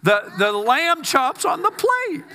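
high, rising exasperated rant; Martha blowing up. Retold or imitated. Martha ranting at Jesus, high and building to a shout. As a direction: let Martha boil over at Jesus. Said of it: "Or not hot dogs".

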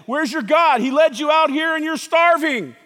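loud, high challenge; taunting devil. Acted. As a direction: jeer at a starving man as the devil. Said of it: "That's what the devil was saying".

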